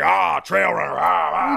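lightly put-on trail runner roar; macho cheer. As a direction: cheer it like a manly grunt. Voicing admirers of macho dog.

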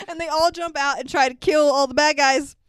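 ordinary talk

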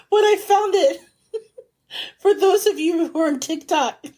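high, giggling through the words; laughing. Real, not acted. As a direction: laugh as you talk. Giggling while recounting.

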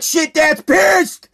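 high, slow, loud mock dread from the kid; mock panicked son. Son getting out of the car, realizing Dad's angry: slow, high mock dread. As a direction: drag it out like a scared teenager realizing trouble. Son's imagined reaction leaving car.

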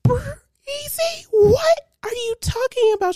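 mom's disbelief. Retold or imitated. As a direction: play mom, shrill disbelief through laughs. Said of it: "my mom was like".

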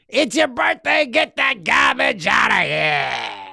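high shouted catchphrase; excited announcer shout. Retold or imitated. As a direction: bellow it like a hype announcer. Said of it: "Jack Armstrong lingo".

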